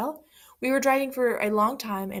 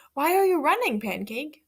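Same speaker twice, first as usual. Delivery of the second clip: curious hen; 6 semitones higher, 3 dB louder than usual. Acted. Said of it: "the hen asked".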